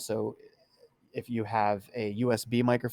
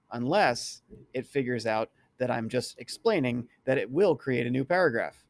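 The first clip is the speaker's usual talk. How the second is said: ordinary talk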